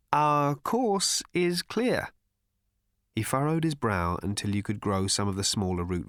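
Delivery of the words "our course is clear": put-on very slow, higher, solemn old man; gravely. Slartibartfast answering Arthur, very slow and grave. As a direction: weigh each word like a verdict. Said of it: "said Slarty Bartfast gravely".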